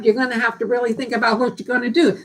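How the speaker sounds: normal talking voice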